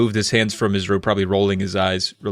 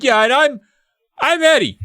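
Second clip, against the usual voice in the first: put-on high, louder kid protesting; insistent correction; 5 dB louder than usual. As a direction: pipe up to correct the old man. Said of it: "And Eddie's like".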